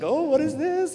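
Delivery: mock-excited, high-pitched gift reaction. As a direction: squeal it like a girl opening a present. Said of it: "she's excited".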